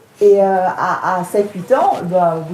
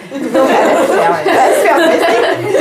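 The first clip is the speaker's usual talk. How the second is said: higher and louder, breaking into real laughter; laughing; 6 semitones higher, 5 dB louder than usual. Real, not acted. Lecturer amused by the novel's absurd gender roles, laughing. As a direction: laugh your way through it, unable to stay serious.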